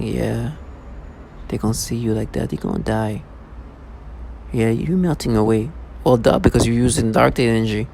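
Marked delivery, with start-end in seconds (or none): 0.0-5.7 low, quieter mocking taunt; a hint of a sneering villain; mock gimmick voice. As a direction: imitate the soft smug threat, a touch lower. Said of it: "speaking in this tone of voices".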